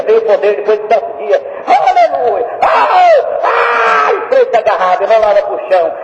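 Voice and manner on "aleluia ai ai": ecstatic shout. Acted. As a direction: burst out in joy. Joy after revival.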